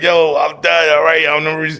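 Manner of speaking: loud and desperate, giving up the fight; pleading shout. Retold or imitated. Begging guards to stop.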